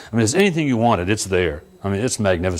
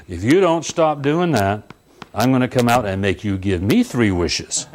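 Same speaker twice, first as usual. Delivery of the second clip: normal talking voice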